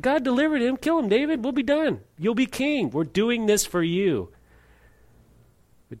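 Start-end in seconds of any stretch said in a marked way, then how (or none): 0.0-4.3 eager advisers pressing David, pitched well up; mock voice of David's men urging the kill. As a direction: egg him on like a hungry soldier. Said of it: "the advice that they gave David".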